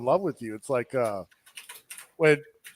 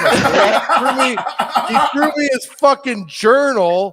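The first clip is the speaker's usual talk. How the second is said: laughing through the words, higher and louder; trying to talk while cracking up; 6 semitones higher, 6 dB louder than usual. Real, not acted. Retelling the journal story to friends, laughing through it.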